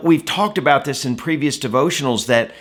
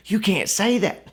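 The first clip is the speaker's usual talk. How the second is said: mock protest; 6 semitones higher than usual. Disciple pulls Jesus to the side, mock protest. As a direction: play at being an appalled disciple.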